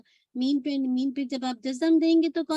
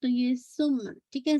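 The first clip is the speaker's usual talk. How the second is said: ordinary talk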